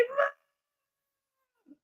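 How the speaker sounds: high, thin mock scream of Mom; retold scream for mom. Kid stepping on jacks, calling mom in mock scream. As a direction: squeal it like a hurt kid calling. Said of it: "I would be screaming, yelling, crying".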